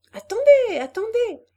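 very high, very loud alarmed shout; everyone shouting. A narrator's reading. The group shouting as Hélène leaps from the swing, alarmed very loud yell. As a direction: yell in alarm as she jumps. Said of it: "cria tout le monde".

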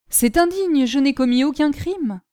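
high, loud outraged protest; indignant protest. A narrator's reading. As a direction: protest the injustice hotly. Prisoner protests his capture.

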